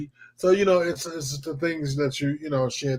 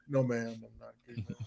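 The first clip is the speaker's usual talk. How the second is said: timid reply; 4 semitones lower, 12 dB quieter than usual. Retold or imitated. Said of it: "looking scared".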